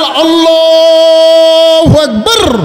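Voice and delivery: high, loud, hugely drawn-out call; takbir cry. Retold or imitated. Shepherd interrupting the iqamah with a takbir, calling out. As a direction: hold the vowel long and ring it out.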